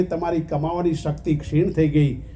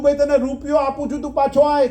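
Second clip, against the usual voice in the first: higher call, offering the rupee; calling out, pleading; 6 semitones higher than usual. Retold or imitated. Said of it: "બૂમ બહુ પાડી". (ઊંચા અવાજે વિનંતીભરી બૂમ)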